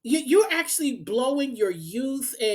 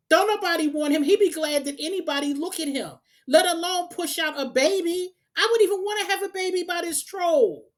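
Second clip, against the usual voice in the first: higher pitched, heated disbelief; getting more and more riled up; worked up; 6 semitones higher than usual. Real, not acted. Ranting to young women about this man, worked up and higher. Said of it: "I done got all emotional".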